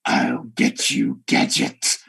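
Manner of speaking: low, slow villain growl; Doctor Claw. Retold or imitated. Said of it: "It's Doctor Claw".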